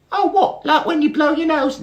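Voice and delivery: very high, loud squeaky beetle voice; high squeaky Bart. Acted. Dim little brother greeting Barry, high squeaky voice.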